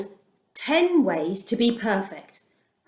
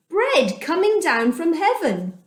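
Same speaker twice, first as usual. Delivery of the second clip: ordinary delivery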